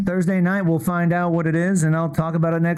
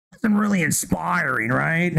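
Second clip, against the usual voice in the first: ordinary talk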